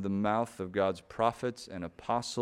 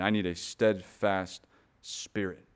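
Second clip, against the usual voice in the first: usual speaking voice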